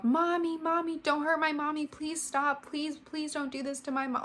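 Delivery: high-pitched little daughter pleading; a hint of a scared little girl begging; lightly voiced screaming child. Little daughter begging from the locked bedroom, lightly voiced high plea. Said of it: "screaming out loud".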